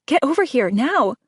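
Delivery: calling out. A narrator's reading. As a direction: call her over, excited. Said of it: "Avery calls".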